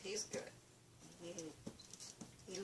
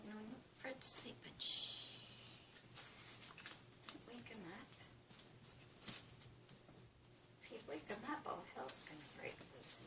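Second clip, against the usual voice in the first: whispering over a crib; 5 semitones higher, 5 dB quieter than usual. Real, not acted. Cat sleeping, don't wake him.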